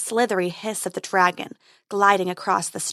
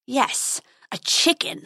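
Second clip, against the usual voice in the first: snarling; 4 semitones higher, slower than usual. Acted. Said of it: "snarled the dragon".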